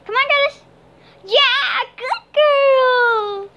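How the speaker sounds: high, loud coaxing call to the cat; sing-song call to bring the cat over; squeaky "come on" to a pet. Real, not acted. Praising cat after fetch.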